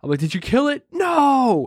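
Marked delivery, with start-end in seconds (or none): 0.2-0.9 calling out. Retold or imitated. Calling through door to friend. 0.9-1.7 high shrill mock no; mock shriek. Imitating the panicked friend shrieking at the spider, high pitched.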